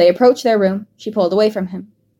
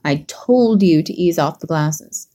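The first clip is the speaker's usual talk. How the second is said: slow, gently scolding; admonishing; 3 semitones lower, slower than usual. A narrator's reading. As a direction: tell her off, unhurried. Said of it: "he admonished".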